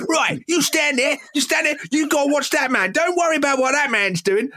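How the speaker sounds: a lightly hammed Cockney manager placing his defenders; Cockney coach voice. Retelling the clip, the coach voiced in mock Cockney telling defenders where to stand. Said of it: "voice less Cockney".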